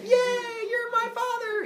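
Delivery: very high squealed cheer; hammed-up little-boy delight; excited child. Boy learning Helios is his father, high excited squeal. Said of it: "he gets all excited".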